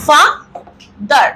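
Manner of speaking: father as fa, long pause, dar; split into two syllables. Shown as a demonstration. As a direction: break father into two separate beats. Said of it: "আমরা দুটো সিলেবেলে উচ্চারণ করব". (father শব্দটা দুই ভাগে থেমে থেমে)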